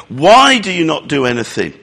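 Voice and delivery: normal talking voice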